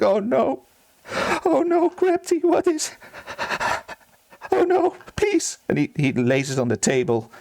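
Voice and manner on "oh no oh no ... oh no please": very high, whimpering acted sobs; hammed-up tearful distress; mock crying. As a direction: wail over your hurt pet in a thin, high voice. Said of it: "he starts crying, and he's like".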